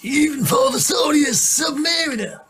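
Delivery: very high, shouted hammed-up American accent. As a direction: yell it like an over-the-top Yank. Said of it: "I've got a better Amer-, American accent than you".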